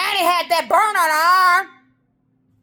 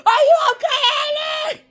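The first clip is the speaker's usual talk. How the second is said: crying shout; 7 semitones higher, faster than usual. Real, not acted. Worried about her auntie on TV, crying out. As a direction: wail it through tears, much higher.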